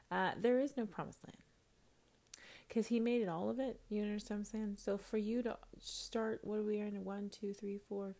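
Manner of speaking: quiet, half-whispered talk; soft hushed sermon-chat. Real, not acted. Talks hushed after break.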